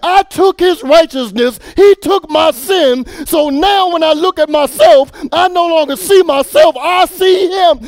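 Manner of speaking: loud, high, rhythmic preaching climax; preacher shouting testimony to the church, rising; full-force preaching. Acted. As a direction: build it like the close of a sermon.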